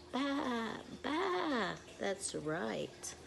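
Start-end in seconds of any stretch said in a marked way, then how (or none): 0.1-1.7 high bleating baa; sheep bleat. Retold or imitated. Teacher to kids, high sheep baa.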